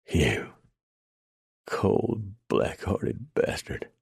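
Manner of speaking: each word pushed out on a dying breath, slowing into breathy gasps; wounded man's gasping curse; gasped. A narrator's reading. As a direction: gasp it out like a man bleeding to death. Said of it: "A man gasped the words at him".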